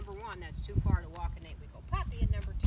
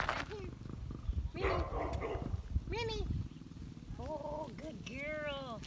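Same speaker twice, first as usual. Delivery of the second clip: puppy talk; 7 semitones higher, much slower than usual. Real, not acted. Coaxing puppy on walk.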